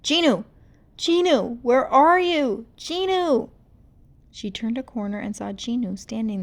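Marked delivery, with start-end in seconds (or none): 0.0-3.5 loud, high furious shouts; hunting him down in rage; angry calling. Acted. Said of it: "Her sad cries turned angry".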